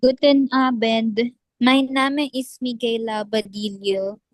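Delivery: ordinary delivery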